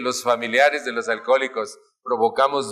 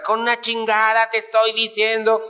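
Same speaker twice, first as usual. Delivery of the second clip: mock yell; 7 semitones higher than usual. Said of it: "empieza a gritar".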